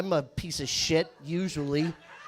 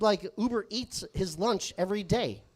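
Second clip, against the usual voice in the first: ordinary talk, nothing marked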